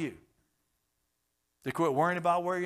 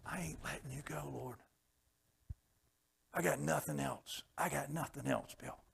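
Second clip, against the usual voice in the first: whispered plea; 6 dB quieter than usual. Retold or imitated. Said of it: "Just like Jacob".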